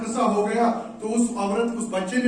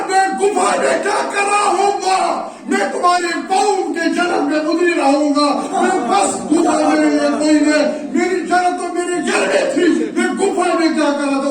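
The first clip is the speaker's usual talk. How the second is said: loud, high cry to mother; anguished outcry; 7 semitones higher, 6 dB louder than usual. Retold or imitated. Son crying out at his mother's feet, loud. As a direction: pour it out, shouting.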